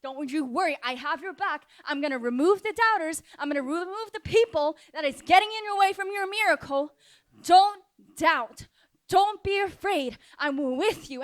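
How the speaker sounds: rising from steady reassurance to high, fervent promise; impassioned vow of protection; Jesus promising. Acted. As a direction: build in pitch and conviction. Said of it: "he's saying right now".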